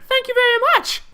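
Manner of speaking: very high voice on the thanks; squeaky thanks. Retold or imitated. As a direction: surprise them with a tiny high voice. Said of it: "because it just like".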